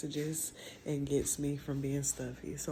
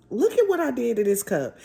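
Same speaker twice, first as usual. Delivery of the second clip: louder, higher, stressing "at"; louder, higher repeat; 8 semitones higher, 11 dB louder than usual. Real, not acted. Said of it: "Y'all, look at what I did to this cup".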